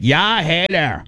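slow Canadian "Yeah, hey there"; Canadian accent. Retold or imitated. To cohosts, explaining Canadians in Canadian accent. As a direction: drawl it like a friendly Canadian. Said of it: "you'd be like".

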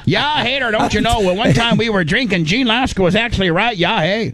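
cartoon Canadian praising Gene; Canadian accent. Host teasing Gene in Canadian voice. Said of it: "Gene Lasker's right".

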